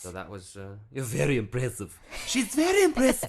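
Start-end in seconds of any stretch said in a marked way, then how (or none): 2.3-3.3 high, louder put-on praising voice; mock praise voice. Host praises actress to listeners, silly high voice. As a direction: gush in a silly pitched-up voice.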